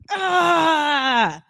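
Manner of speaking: a fed-up groan of exasperation; frustrated yell. Acted. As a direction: let out a burst of frustration. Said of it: "You just kinda wanna just".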